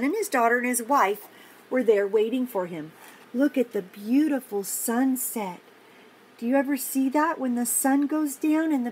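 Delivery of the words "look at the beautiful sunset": ordinary delivery, nothing marked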